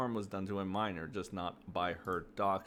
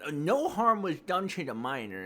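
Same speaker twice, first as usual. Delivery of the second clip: higher and louder mock reading; mocking quote; 6 semitones higher, 6 dB louder than usual. Quoting a commenter, in a mocking higher voice. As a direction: read their claim back with a sneer. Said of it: "So it said".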